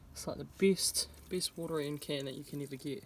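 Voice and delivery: ordinary delivery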